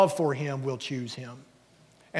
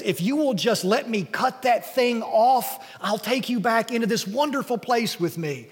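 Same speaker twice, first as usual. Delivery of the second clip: angel's plea; 7 semitones higher than usual. Acted. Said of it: "this angel walks up and says".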